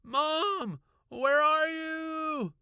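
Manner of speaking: high, loud, long drawn-out shout; desperate calling. A narrator's reading. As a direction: call out frantically through the house. Calling through house for mother.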